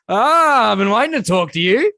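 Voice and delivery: high, loud, theatrical mock-villain greeting; imagined villain. Mate turns in his chair stroking his cat, mock-villain voice. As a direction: ham it up like a villain swivelling round to his visitor. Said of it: "this is how I picture it".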